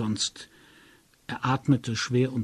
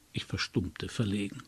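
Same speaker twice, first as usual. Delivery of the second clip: usual speaking voice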